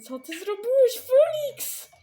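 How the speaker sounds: high, loud outburst rising on the name; panicked reproach. Acted. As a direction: demand an answer in alarm. Panicked confrontation with Felix. (spanikowany, głośny wyrzut)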